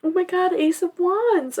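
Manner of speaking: high, tearful and bewildered; crying. Real, not acted. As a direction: talk through real tears. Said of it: "Why am I crying?".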